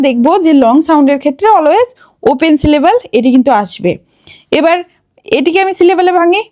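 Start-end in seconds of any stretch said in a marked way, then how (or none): none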